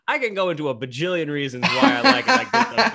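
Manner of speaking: giggling rush of enthusiasm; laughing excitedly. Real, not acted. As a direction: gush with a laugh as it runs away from you.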